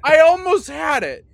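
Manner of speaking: high, loud exasperated cry; frustrated shout. Acted. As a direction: yell it in frustration. Lock pick fails, door opens.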